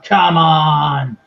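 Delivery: stretched, imitated battle call; Shinsuke Nakamura catchphrase. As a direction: belt it out as a showy taunt. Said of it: "the classic Shinsuke Nakamura".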